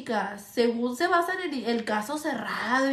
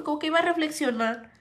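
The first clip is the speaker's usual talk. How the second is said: ordinary delivery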